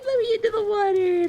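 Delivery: squeaky high gremlin whine. Pretend. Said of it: "Gremlin noises?".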